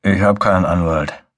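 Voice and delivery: faraway, hungover voice; distant voice. Acted. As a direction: sound far off and dazed. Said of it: "Seine Stimme klang sehr fern".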